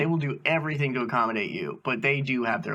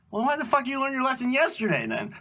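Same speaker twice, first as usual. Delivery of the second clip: exasperated inner retort; 12 semitones higher than usual. Retold or imitated. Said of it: "in my mind, I'm thinking".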